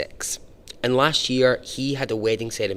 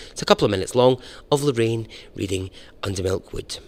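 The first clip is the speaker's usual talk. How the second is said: ordinary talk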